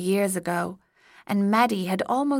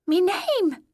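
goblin voice; 12 semitones higher, 3 dB louder than usual. A narrator's reading.

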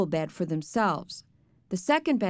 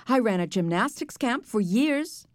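plain reading voice

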